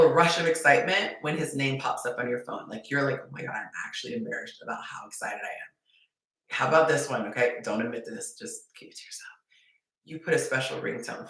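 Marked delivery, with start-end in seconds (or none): 3.2-5.7 fast, breathless secret whisper; excited whisper. Retold or imitated. Secret excitement at his text. 8.4-9.3 conspiratorial whisper. Real, not acted. Advising secrecy after "don't admit this.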